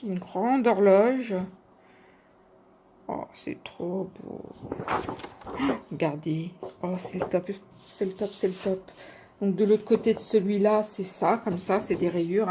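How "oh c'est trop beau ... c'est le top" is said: awed whisper. Real, not acted. Admiring paper in awe.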